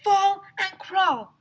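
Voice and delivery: high, loud, slow chanted rule; shouting. A narrator's reading. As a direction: call it out. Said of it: "shouted Alexia".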